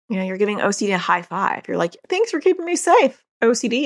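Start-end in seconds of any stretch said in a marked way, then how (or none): 2.1-3.8 mock thankful child.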